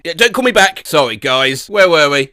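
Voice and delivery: ordinary talk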